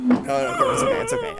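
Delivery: high, hammed-up comforting; calm him down, over the top; mock soothing. Comforting the scared friend.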